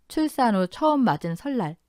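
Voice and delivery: plain reading voice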